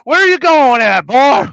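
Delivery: shouted, very high Southern holler; hammed-up Southern accent. Streamer hunting for his Southern accent, loud hollered Southern caricature. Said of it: "We'll figure out an accent eventually".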